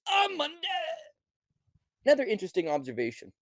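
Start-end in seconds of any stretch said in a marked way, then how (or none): 0.1-0.7 high mock shout; mock fighter shout. Fighter shouting to the ref, high mock yell. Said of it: "Then he says".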